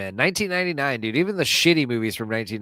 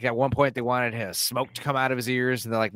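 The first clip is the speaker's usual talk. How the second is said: usual speaking voice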